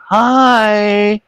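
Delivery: put-on high, drawn-out child's greeting; imitated little girl. Imitating a four-year-old at the park bench, little-girl voice. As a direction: pipe up like a small child. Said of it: "about a four-year-old little girl".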